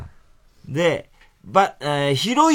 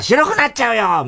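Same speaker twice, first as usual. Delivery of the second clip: high, raised comeback; model retort; 6 semitones higher, 4 dB louder than usual. Shown as a demonstration. Host shows the missed retort, raised high voice. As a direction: call out the punchline as a demo.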